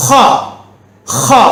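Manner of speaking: ஹா as a rasped 'kha'; ஹா begun with a scraping kh; throat-clearing kh. Shown as a demonstration. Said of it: "கொஞ்சம் காரி உச்சரிக்கணும்".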